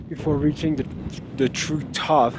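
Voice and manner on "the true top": ordinary delivery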